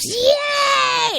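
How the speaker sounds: high, loud whooping cheer; wild celebratory yell; erupting class cheer. Retold or imitated. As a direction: scream it like a whole class erupting. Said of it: "The class erupts".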